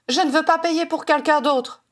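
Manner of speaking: high, agitated refusal; crying out. A narrator's reading. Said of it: "cria-t-elle". (refus crié, voix plus aiguë)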